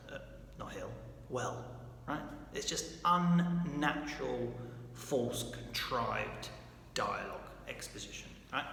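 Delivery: normal talking voice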